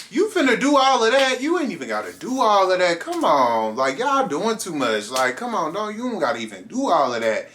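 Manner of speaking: high, loud come-on-now pleading; exasperated protest. Retold or imitated. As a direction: push back hard, then ease off. Protesting guard calling it in.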